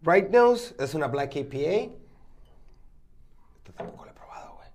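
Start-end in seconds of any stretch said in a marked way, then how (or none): 3.6-4.7 hushed, unvoiced aside; whisper. Real, not acted. Friends tasting beers, whispering an aside. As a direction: drop to a breathy whisper for a quick aside. Comparing beers quietly on mic.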